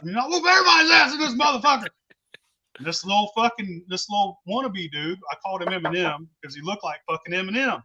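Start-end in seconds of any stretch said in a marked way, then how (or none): 0.2-1.9 high-pitched, fast acted threat; riled up. Drunk man challenging everyone around him, riled up. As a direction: rattle off a riled-up challenge to all comers. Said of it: "I was fucking riled up".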